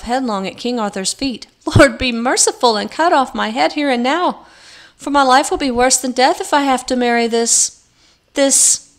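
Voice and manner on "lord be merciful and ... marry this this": loud, higher desperate plea; desperate pleading. A narrator's reading. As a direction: beg for death in despair. Knight begs in despair.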